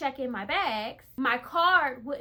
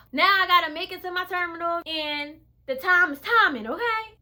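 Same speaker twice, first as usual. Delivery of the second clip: higher, louder, faster rush; frantic; 5 semitones higher, 6 dB louder than usual. Retold or imitated. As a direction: race through it like you're late for a flight. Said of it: "I started panicking again".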